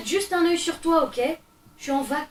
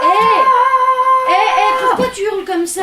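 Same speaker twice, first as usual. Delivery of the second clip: high, very loud protest; exasperated shouted interruption; yelling over screams; 9 semitones higher, 12 dB louder than usual. Acted. Yells over the child's screaming.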